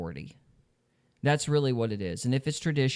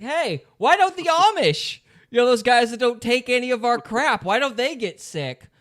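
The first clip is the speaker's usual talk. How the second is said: mocking Trump voice; 9 semitones higher, 6 dB louder than usual. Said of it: "It's like".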